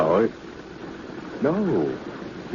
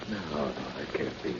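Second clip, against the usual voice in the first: much softer, under the breath; quiet disbelief; 4 semitones lower, 12 dB quieter than usual. Acted. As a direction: say it to yourself, barely aloud. Barclay musing alone, doubting.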